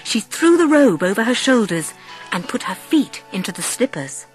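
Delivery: usual speaking voice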